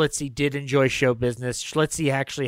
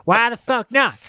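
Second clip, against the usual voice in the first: put-on Kentucky accent; 8 semitones higher, 5 dB louder than usual. Director Todd defending the castration scene, in a loud mock Kentucky accent. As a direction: play Todd as a riled-up Kentucky boy. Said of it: "that was my best Kentucky accent".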